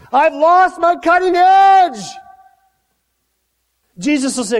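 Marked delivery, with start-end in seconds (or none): 0.1-2.0 high, drawn-out desperate shout; crying out. Acted. Believer crying out to Jesus, high anguished shout. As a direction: cry it out at full voice, slowly. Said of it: "you gotta cry out".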